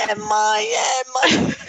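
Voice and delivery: high excited insisting cry; eager child. Retold or imitated. Son pressing his mom for an answer, excited. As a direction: push it up high and urgent.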